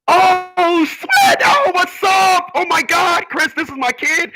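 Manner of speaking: very high, loud squealing greeting; excited shriek. Retold or imitated. Family member squealing hello at the hospital. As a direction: shriek with joy at seeing him again.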